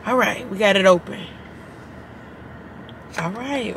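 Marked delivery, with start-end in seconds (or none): none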